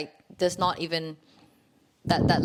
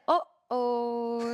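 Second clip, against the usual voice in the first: high put-on "Uh-oh" with a laugh in it; mock the trainer's comic uh-oh; funny Mister Wong; 5 semitones higher than usual. Friend imitates the trainer's playful uh-oh, pitched up. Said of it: "he literally said that".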